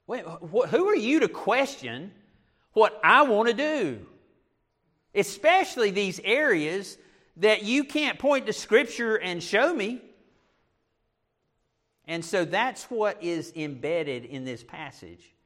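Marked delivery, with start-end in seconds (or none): 0.1-10.0 higher, louder mock indignation; indignant challenge. Preacher acts out challenging whoever questioned his choices, indignant. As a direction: push back as if your rights were questioned.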